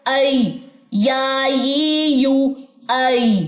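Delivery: ऐ या ये यू each drawn out clearly; recited ya sounds. Shown as a demonstration. Teacher drilling ya vowels for students, clear recitation. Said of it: "तो ये कैसे पढ़ा जाएगा?".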